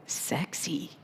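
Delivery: very soft, sly imitation; hushed teasing. Servant woman recalls their joke, hushed imitation. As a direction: murmur it like a shared secret joke.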